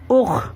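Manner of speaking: اخ sounded out as "ukh" with throaty kh; isolated, demonstrated اخ, "ukh"; two letters joined. Shown as a demonstration. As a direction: run short u into kh in اخ. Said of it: "اس طرح ملائیں گے".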